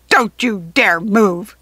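loud, very slow menacing order; goblin screech. A narrator's reading. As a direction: screech it as a threat, word by word. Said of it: "the goblin screeched at Missy".

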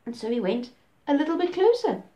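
ordinary delivery

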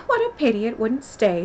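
ordinary delivery

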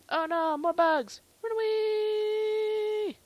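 high, loud, long-drawn cry; mock panic. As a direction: wail it out in hammy alarm.

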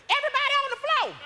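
high-pitched would-be stickup command; unthreatening robber. Retold or imitated. Failed thug robbing a packed bank, high-pitched yell. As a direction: order them down in a comically high voice. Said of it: "my voice don't agree with the thug activities".

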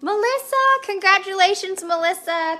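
hyped winner reveal, high and loud; excited. Real, not acted. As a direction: call out the winner's name with joy. Winner announced in giveaway.

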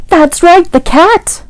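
put-on spring the surprise on the kids; excited reveal. A narrator's reading. Reader reveals the cat to children.

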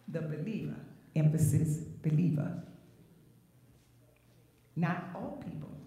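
ordinary talk

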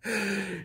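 short amused laugh; real laugh. Real, not acted. Preacher laughing at his own retelling, amused laugh. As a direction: laugh at your own joke.

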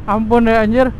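normal talking voice

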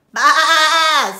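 long, very loud bleat; sheep baa. A narrator's reading. Sheep hears of the plot, loud bleating. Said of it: "said the sheep".

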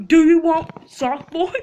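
high, loud challenge; loud stammered threat; loud taunt. Real, not acted. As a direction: shout it at your co-player. Mock threat to sock boy.

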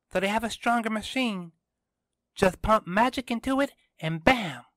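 put-on high, brisk girl character voice; Kiara arguing, insistent. A narrator's reading. Kiara disputes the robot's ending with Tristan, high insistent voice.

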